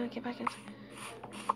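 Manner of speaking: slow breathy whisper of the phrase. Shown as a demonstration. Repeats phrase, then whispers it.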